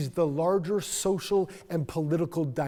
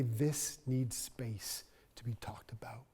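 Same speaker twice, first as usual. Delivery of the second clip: hushed whisper; 4 semitones lower, 7 dB quieter than usual. Acted. Quoting a plea for space.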